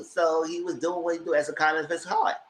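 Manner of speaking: ordinary talk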